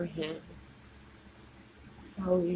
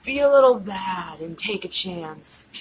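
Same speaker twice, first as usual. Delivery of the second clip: louder, pleading dare; loud plea; 2 semitones lower, 6 dB louder than usual. Real, not acted. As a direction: push it out loudly, coaxing. Drunk plea to a crush.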